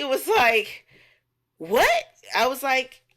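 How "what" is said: high, loud mock disbelief; mock shock. Retelling a man refused at the bar, shocked. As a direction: blurt it high as the stunned suitor.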